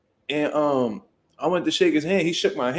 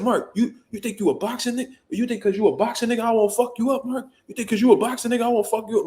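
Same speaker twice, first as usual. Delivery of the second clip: lightly voiced hyped old head; 6 semitones higher than usual. Voicing a hyped older man threatening.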